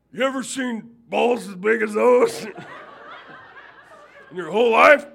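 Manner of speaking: high, loud bragging that breaks into a laugh; loud laughing brag. Retold or imitated. Mister Cook bragging to the minister about his dog, loud with laughter. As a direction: brag and cackle at once.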